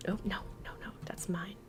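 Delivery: hushed whisper, protective. Real, not acted. Streamer guarding her loot from chat, whispered. Refusing to give item away.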